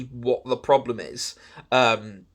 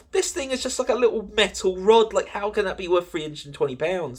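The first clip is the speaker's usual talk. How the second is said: incredulous outrage; 7 semitones higher than usual. Retold or imitated. Jaw hits floor at price.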